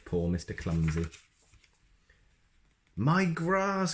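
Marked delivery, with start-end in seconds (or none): 3.0-3.9 high fussy cry; a much higher wail over the lawn. Acted. Mister Fussy crying over his bent lawn, high and dismayed. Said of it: "cried Mister Fussy".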